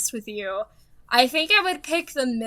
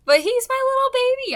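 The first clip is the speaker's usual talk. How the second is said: higher cooing voice; doting pet talk; 12 semitones higher, 4 dB louder than usual. Real, not acted. Calling her cat her little baby, higher doting pet voice. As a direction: coo over the cat.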